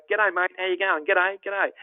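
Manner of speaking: cheerful Aussie hello, Australian accent; Aussie greeting. Shown as a demonstration. Tour guide greeting shoppers in Australian accent. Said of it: "Everywhere you go".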